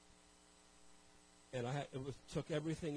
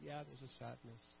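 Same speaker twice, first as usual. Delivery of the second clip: sad admission; 3 semitones lower, 10 dB quieter than usual. Retold or imitated. Grieving admission over dinner.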